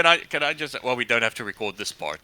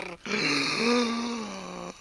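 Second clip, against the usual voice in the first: gasp for air; 8 semitones higher, 6 dB quieter than usual. Real, not acted. Out of breath after long list.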